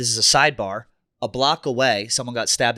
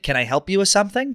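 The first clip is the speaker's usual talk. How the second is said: curt, raised voice, brushing him off; a stranded rider snaps at a helpful stranger, rudely; snotty retort; 7 semitones higher than usual. Retold or imitated. Irritated retort to the approaching stranger. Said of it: "I was such a dick".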